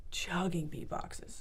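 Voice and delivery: near-whisper, drawn out slowly; hushed confession. Real, not acted.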